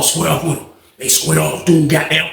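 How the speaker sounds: normal talking voice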